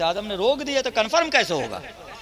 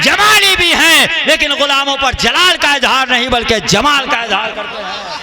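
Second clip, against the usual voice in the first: loud, high, slow ecstatic praise; preaching at full force; 11 semitones higher, 12 dB louder, slower than usual. Acted. Preacher praising Ghaus-e-Azam before the gathering, fervently proclaimed. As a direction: cry out the titles of the saint to the crowd.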